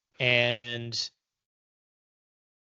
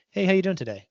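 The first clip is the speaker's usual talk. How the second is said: breezy American greeting, higher and louder; American accent; 7 semitones higher, 7 dB louder, faster than usual. Acted. Learner greeting someone in a perfect American accent. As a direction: put on a casual American accent. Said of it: "they say in a perfect American accent".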